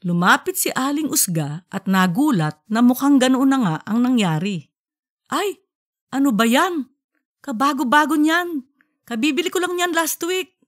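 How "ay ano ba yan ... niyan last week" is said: high, complaining wife's voice; dismayed wife. Acted. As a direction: lament the new fan, pitched high. Wife dismayed at burnt motor.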